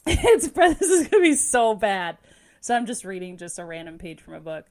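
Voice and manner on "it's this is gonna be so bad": high, loud, giggly warning; squealing with embarrassed laughter; laughing disclaimer. Real, not acted. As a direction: laugh through an apology before performing. Laughing nervously before her accent demo.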